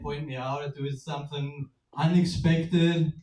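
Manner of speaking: ordinary talk, nothing marked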